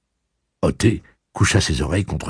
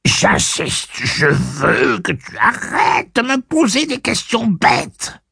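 whiny insistence; 4 dB louder, slower than usual. Acted. Blaine whining insistence.

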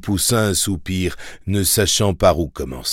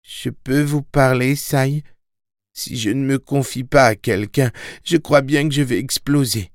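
put-on higher, troubled young boy; anxious boy; 7 semitones higher than usual. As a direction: plead to confide, boyish. Boy voice pleading. (garçon troublé, voix plus aiguë)

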